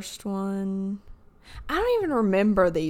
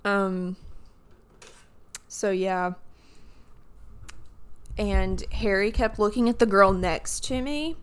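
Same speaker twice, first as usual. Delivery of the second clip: ordinary delivery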